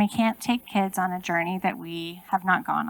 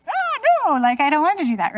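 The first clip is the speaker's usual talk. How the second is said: put-on reluctant kid; 9 semitones higher, 4 dB louder than usual. Kids react to stern stare.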